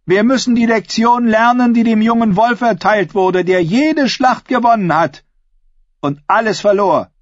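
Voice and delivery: high-pitched, forceful oratory to a hall; defiant female claimant. Acted. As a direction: challenge the crowd like a contender for the crown. Asha addresses the assembly.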